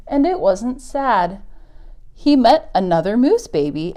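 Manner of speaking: gentle picture-book read-aloud; story reading. Acted. Teacher reading a moose story to kids, storybook voice. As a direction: read it to the little ones, unhurried.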